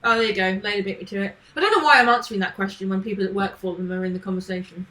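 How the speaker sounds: ordinary delivery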